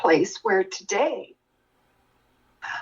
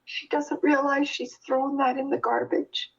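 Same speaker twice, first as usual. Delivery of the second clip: pitched up high in dismay; thinking in sudden alarm, voice jumping up; alarmed inner thought; 6 semitones higher than usual. Retold or imitated. Daughter sees mother toss peel in garbage, alarmed.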